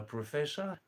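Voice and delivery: usual speaking voice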